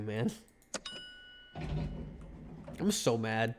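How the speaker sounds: ordinary delivery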